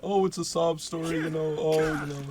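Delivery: high, put-on self-pitying voice; mock sob story. Speaker mocks the way he refuses to talk, fake self-pity. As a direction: mimic someone milking their sad story. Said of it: "I don't wanna be like".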